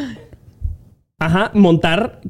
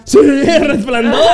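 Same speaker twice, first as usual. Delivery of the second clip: excited shout; 6 semitones higher than usual. Real, not acted. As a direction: call it out gleefully, laughing. Guessing the right answer.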